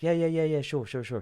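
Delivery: breezy, repeated reassurance; confident trickster. Retold or imitated. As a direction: mimic a shoplifter bluffing easily. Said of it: "they're really confident".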